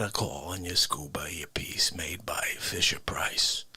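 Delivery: low, soft whispered narration. Acted. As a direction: hush it like a secret. Whispered absurd scenario.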